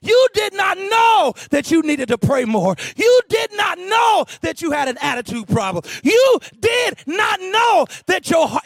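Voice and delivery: high, driving sermon shout; full-force preaching. Real, not acted. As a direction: preach it hard, drawing out the key words.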